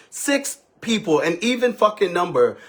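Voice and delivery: usual speaking voice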